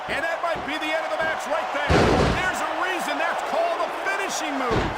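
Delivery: high-pitched play-by-play excitement; excited commentary. Acted. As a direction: call the finisher like the match hangs on it. Commentator calls the finisher.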